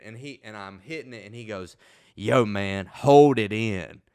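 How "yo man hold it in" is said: loud, slow urging to hold it in; drawn-out yell of hold it in; friend urging. Retold or imitated. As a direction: bark it out slow like a stoner buddy. Friend urging him to hold smoke.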